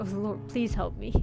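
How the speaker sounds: ordinary talk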